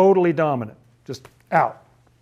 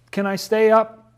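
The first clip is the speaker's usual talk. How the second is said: put-on high, slow wheedling kid; pleading child; 8 semitones higher than usual. Pastor acting a child bargaining at bedtime, lightly high and pleading. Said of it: "you bargain".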